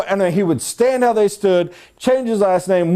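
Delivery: usual speaking voice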